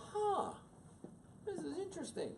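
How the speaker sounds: high, airy musing; mock detached curiosity. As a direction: ponder it with put-on innocence. Mock calm self-talk of a teenager.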